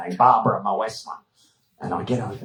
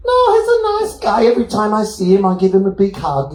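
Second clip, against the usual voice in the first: put-on high-pitched girlfriend; 9 semitones higher, 4 dB louder than usual. Babsy high-pitched reply.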